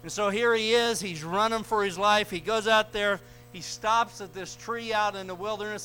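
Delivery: preacher telling the congregation of Elijah's flight, preaching at full force. Acted.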